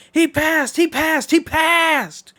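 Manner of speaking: high, loud excited call; race commentary. Acted. Announcer calling Dio's pass at the finish, thrilled. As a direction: shout the overtake to the stands.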